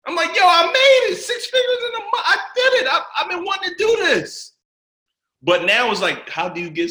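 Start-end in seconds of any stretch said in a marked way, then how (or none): 0.1-4.5 screaming out the big win as it lands; ecstatic shout. Retold or imitated. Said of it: "I was like".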